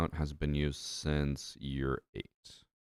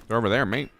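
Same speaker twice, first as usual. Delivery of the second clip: raised, louder British attempt drifting Australian; Australian-sounding accent; 5 semitones higher, 6 dB louder, faster than usual. Shown as a demonstration. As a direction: put on an amateur Australian-style twang. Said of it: "That sounds Australian".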